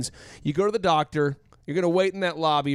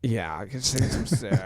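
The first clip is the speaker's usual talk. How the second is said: whiny sick voice; 6 semitones lower, 3 dB quieter than usual. Retold or imitated.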